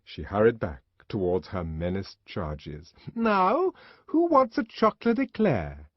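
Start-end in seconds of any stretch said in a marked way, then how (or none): 3.2-5.9 high, bright, louder coaxing call; loud cheerful tone. A narrator's reading. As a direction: lure the children away cheerfully. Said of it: "in a loud, cheerful tone".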